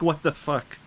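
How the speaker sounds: hammed-up disbelief. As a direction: throw it out in mock shock. Snake eats the flower.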